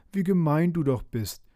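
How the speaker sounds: mildly outraged storybook flower; indignant. A narrator's reading. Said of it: "erregte sich der Löwenzahn".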